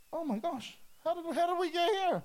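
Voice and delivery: high-pitched acted amazement; mock astonishment. As the brother's crying, acted high astonishment. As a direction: gasp it out high, amazed at where you are.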